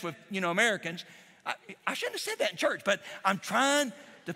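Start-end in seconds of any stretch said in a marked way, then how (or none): 1.5-2.8 quick, high, sheepish aside to the church. Real, not acted. Pastor apologizing to church for kick butt, quick and sheepish. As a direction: rush out a playful apology, pitched up.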